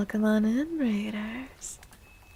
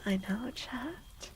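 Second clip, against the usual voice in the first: whisper; 2 semitones higher, 7 dB quieter, slower than usual. Real, not acted. Whispered aside to chat.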